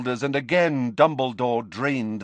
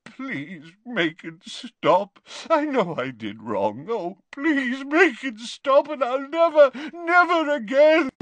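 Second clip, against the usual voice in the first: sobbing Dumbledore; 10 semitones higher than usual. Acted. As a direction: beg through sobs, pitch rising to a wail. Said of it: "he sobbed".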